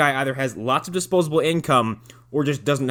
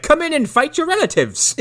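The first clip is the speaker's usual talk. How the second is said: high loud hammed-up welcome; mock Irish host; 14 semitones higher, 5 dB louder than usual. Joking about an Irish theme park church, high hammy call. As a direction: bellow a hammy invite.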